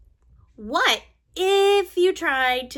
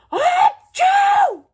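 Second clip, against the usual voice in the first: a big high shouted sneeze; loud shouted achoo; 8 dB louder than usual. Acted. Gerald sneezes at Doctor Cat, a loud high achoo. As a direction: blast the sneeze out.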